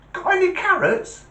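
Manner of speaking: very high, squeaky little rabbit voice; joke rabbit. Acted. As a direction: pipe it up high as a cheeky bunny. Rabbit asks again in joke.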